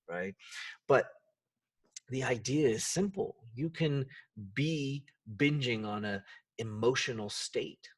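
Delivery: ordinary delivery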